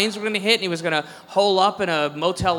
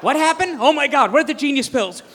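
mock panic; 7 semitones higher, 5 dB louder than usual.

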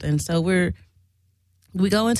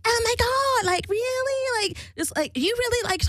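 imitating jealous woman. Mary in her ear during a lap dance, high mimicked disbelief.

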